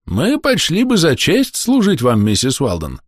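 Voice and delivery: put-on high, slow, earnest old man's voice; drawn-out, high-pitched old man's reply; old Tom. A narrator's reading. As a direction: voice an old man speaking slowly and high, dropping at the end. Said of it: "сказал старый Том".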